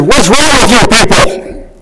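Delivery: a mock-upset cry at a frivolous question; upset yell. Sproul retorting to the Q and A question with an exasperated cry. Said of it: "he actually got upset and yelled".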